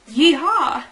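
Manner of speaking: loud, playful yeehaw; hearty mock-cowboy holler; cowboy cheer. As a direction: belt out a cowboy whoop.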